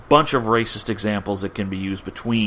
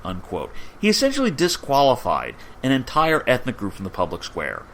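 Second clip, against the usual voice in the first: ordinary talk, nothing marked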